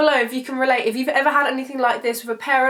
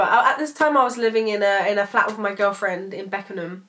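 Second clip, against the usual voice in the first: ordinary delivery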